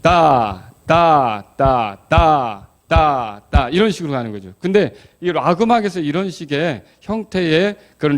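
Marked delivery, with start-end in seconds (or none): 0.0-4.5 syncopated ta ta. Shown as a demonstration. Teacher chanting a syncopated rock rhythm, accented. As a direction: chant the beat with a syncopated push. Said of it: "이거 어떻게 치죠".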